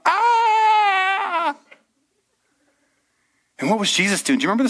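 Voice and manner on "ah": high, loud panicked scream; hammed-up terrified yell. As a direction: scream like a sailor in a sinking boat. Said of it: "They started freaking out".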